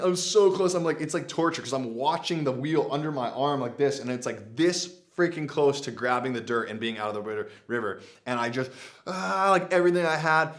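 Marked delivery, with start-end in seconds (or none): 0.0-0.7 strained and louder; straining. Real, not acted. Straining to pull out vehicle. 9.1-10.5 loud, straining effort. Real, not acted. Giving last effort.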